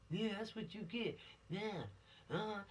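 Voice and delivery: a mild gloating voice; smug, taunting in a light mock; gloating killer. Imitating a gloating killer to teens, lightly. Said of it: "You're laughing on the inside".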